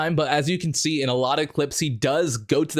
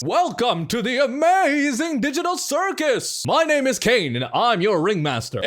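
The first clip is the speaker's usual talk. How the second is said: bombastic high ringmaster voice; big, over-the-top circus showman announcing; Kane; 8 semitones higher, 4 dB louder than usual. Acted. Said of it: "doing some of his lines".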